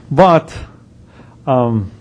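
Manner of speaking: ordinary delivery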